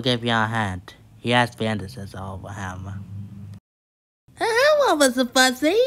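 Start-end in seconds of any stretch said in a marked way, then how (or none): 4.3-5.8 very high, loud greeting; Mister Bump. A narrator's reading. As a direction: squeal a friendly hello with a smile. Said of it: "smiled Mister Bump".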